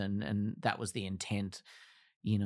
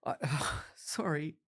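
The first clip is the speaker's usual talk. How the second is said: sighing apology through tears; tearful sigh; 4 semitones higher than usual. Real, not acted. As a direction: let the tears catch the word. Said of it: "I was gonna cry in this".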